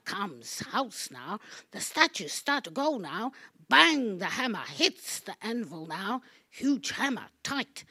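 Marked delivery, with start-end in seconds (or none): 3.7-4.2 shouted bang. Acted. Hammer blow sound.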